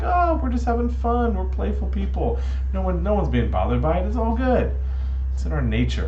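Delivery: high, loud breezy excuse; carefree monkeys. Acted. Monkeys tell Noah it's harmless fun, carefree. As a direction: brush it off in a high voice. Monkeys brush off Noah's complaint.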